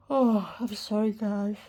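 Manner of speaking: talking through a real yawn; words stretched by a yawn. Real, not acted. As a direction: apologise while stifling a yawn. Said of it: "I was sorry about the yawn".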